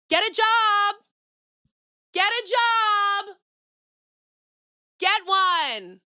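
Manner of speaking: high, loud yell with the last ones slowed right down; angry shout. Real, not acted. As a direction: shout it through the wall at the neighbors. Yelling at noisy neighbors.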